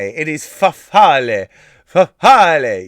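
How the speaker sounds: loud, hammed-up Italian accent on fafale. Shown as a demonstration. Quiz host reading the answer, showy Italian accent. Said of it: "say it with the accent".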